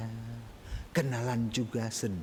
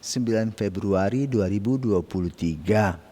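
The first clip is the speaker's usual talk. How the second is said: bright, raised welcoming announcer voice; opening a daily devotional broadcast, louder; formal greeting; 6 dB louder than usual. Acted. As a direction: greet the congregation warmly and loudly.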